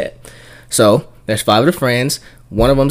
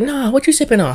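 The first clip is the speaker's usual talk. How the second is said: put-on high girl voice; 13 semitones higher than usual. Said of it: "She was like".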